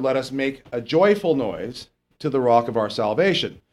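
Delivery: usual speaking voice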